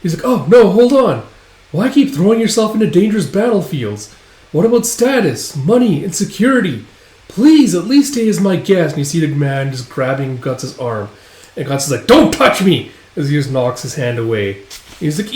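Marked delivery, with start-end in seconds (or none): 0.2-8.9 high, insistent begging; hammed-up urgent pleading in a high voice; pleading employer. Employer begs Guts to stay. 12.1-12.9 high, loud outburst; sudden acted shout of anger; angry warning. Guts knocks the hand away.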